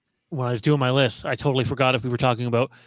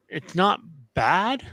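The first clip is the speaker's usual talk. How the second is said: usual speaking voice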